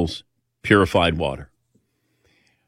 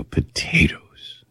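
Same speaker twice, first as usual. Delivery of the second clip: whisper; 5 semitones lower, 3 dB quieter, much slower than usual. Retold or imitated. Echoing the doctor's secret word.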